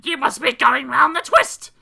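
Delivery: high, loud scornful voice; a mother dismissing nonsense. A narrator's reading. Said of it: "his mother hissed".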